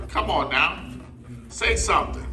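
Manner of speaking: normal talking voice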